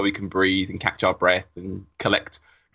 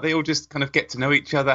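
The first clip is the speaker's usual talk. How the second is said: ordinary talk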